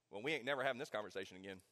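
put-on a boy's quiet, fast private resolve; inner vow. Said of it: "consciously making that decision".

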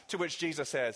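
low, hushed pastoral voice, drawing the listener close; quiet tender sermon passage; soft intimate preaching. Acted. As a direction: lean in and speak tenderly to the lost.

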